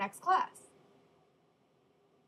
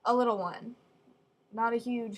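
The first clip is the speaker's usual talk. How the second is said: ordinary talk, nothing marked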